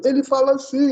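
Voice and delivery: high, loud imitation of unmonitored speech; imitated deaf speaker. Teacher shows how a deaf person sounds, imitated voice. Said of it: "Não tem aquela voz meio, meio diferente".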